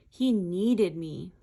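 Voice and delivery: ordinary delivery, nothing marked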